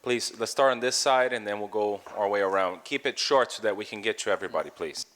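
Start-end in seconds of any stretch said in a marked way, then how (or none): none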